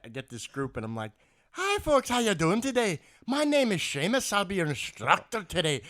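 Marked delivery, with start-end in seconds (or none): 1.5-5.8 high, loud cartoon leprechaun Irish; over-the-top Irish accent. Instructor greeting his group on Saint Patty's Day, hammed-up Irish accent. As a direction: ham up the Irish brogue, big and high. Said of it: "really going over the top with it".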